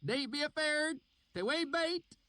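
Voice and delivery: high rustic country drawl; oily backwoods dialect, called out; reassuring strangers in a thick rural accent; thick valley accent. A narrator's reading. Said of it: "his accent so thick and oily".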